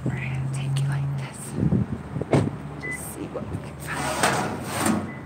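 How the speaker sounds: hushed whisper as she looks in; soft unvoiced whisper, slowing down. Real, not acted. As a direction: keep it secret, barely voiced. Creeping up to the dumpster.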